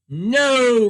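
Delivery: loud, blunt No; a mean man's flat loud refusal; curt refusal. Acted. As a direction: slam the door on the request. Mister Mean refuses washerwoman.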